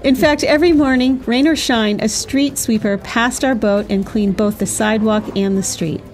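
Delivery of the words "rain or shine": ordinary talk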